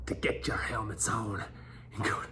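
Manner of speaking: whispering. Acted. Whispered order.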